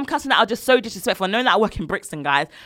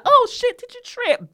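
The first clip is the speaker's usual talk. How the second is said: very high mock concern; fake innocence; slower than usual. Worker pretending the customer tripped by accident, mock-innocent. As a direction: feign surprise in a high little voice.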